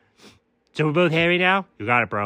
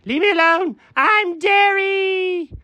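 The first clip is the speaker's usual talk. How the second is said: ordinary delivery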